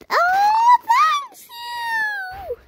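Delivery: very high squealing cry of thanks. Acted. Answering a sweet comment, squealing with joy. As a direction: shriek the thanks like an overjoyed kid.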